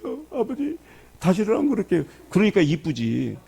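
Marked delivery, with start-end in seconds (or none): 0.0-2.0 put-on high, childlike plea; pleading son. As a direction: beg like a sorry little boy. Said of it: "그래도".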